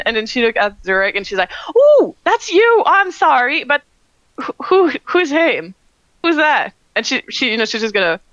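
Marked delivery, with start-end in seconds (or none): 1.7-6.7 excited and curious. Acted. Gaia excitedly asks about Zurich.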